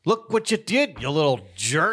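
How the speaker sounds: high, loud mock scolding; hammed-up cruel parent voice; wicked stepmother. Continued mock scolding of child.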